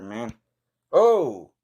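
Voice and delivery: ordinary talk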